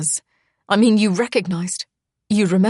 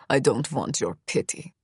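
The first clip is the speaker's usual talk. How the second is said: lower, softer, cold hissed line; 5 semitones lower, 5 dB quieter than usual. A narrator's reading. Misha rejecting Charlotte's pity, a low cold hiss. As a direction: spit it quietly with contempt. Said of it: "he hisses".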